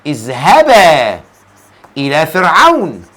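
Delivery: low, stretched اذهبا with a long final alef; slow word-by-word recitation; drawn-out repeat. Shown as a demonstration. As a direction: hold the end of اذهبا, then pause. Repeats verse slowly to show ending.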